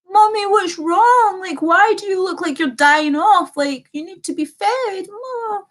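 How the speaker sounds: high, slow little-kid voice imitating him; mock baby voice; childlike whine. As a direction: whine like a small child calling for mommy. Imitating needy boyfriend as child.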